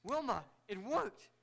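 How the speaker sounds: thrilled inventor crying out; excited. Acted. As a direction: burst out with joy at the success. Invention succeeds, doctor exults.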